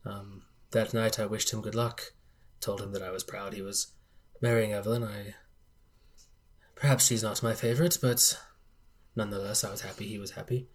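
soft, subdued bereaved father; hushed, halting old gentleman in mourning; grieving old patriarch. Acted. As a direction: recall a lost son very quietly.

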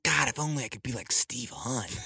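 soft, hushed mock longing; put-on dreamy undertone; wistful admirer. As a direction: sigh it quietly to yourself. Acting an admirer of Steve.

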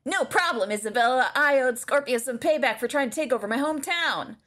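high cartoon boy; Bart Simpson. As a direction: read it in the cartoon kid's voice. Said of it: "Bart says".

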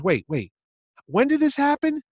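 startled Chaykin. Retold or imitated. Chaykin taken aback.

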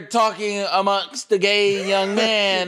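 mocking coworker's voice. Imitating the coworker reporting him to the supervisor, mocking. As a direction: ham up the coworker's prim report. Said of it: "and they like".

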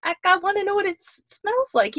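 high whiny voice. Retold or imitated. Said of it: "It's like".

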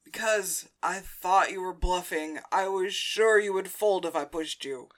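loud defensive explanation; Hector, defensive. Acted. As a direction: justify yourself, lightly acted and loud. Voices Hector justifying himself.

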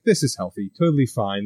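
mock reassurance, voice dropped low; sarcastic. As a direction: say it dry, clearly not fine. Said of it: "I was like".

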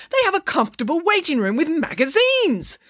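very high, indignant splutter; squawked, affronted objection. A narrator's reading. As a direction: burst out in flustered protest. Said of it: "spluttered the doctor".

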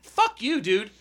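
high-pitched mock outburst; retold curse. Buyer after hanging up on supplier, retold indignant curse. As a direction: toss off an indignant parting curse.

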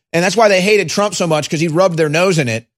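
ordinary talk, nothing marked

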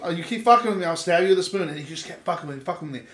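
ordinary delivery

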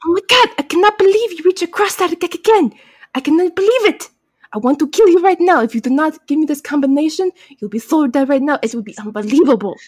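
exasperated villain in a put-on accent; hammed-up accent, outraged then settling; Kamun Ra. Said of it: "please forgive my horrible accent".

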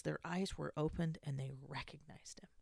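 whispered, reverent scripture line; hushed reading. Acted. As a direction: breathe it out in awe. Reading the key scripture phrase.

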